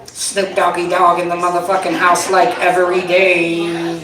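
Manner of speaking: slow, drawn-out rapper drawl. Acted. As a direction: draw it out lazily like a laid-back rapper. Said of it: "This is [name] during the covid lockdown".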